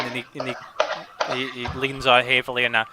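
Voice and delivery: ordinary talk, nothing marked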